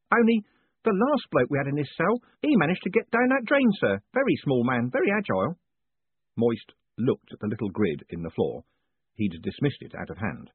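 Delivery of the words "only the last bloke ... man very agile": a beaming prison warden teasing his prisoner; jovial warden. A narrator's reading. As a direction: play the cheerful warden gloating kindly. Warden tells of last prisoner.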